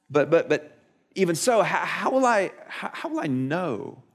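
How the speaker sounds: mock hesitant stutter, dragging out the question; acted flustered doubt. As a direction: play a sheepish doubter fumbling for words. Zechariah imagined stammering his doubt.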